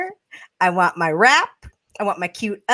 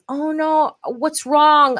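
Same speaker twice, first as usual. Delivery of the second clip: slightly higher, mock-concerned boyfriend; worried boyfriend; 5 semitones higher, much slower than usual. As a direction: lightly imitate a partner rushing to fix things. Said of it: "let's say my boyfriend says".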